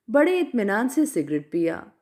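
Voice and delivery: ordinary delivery, nothing marked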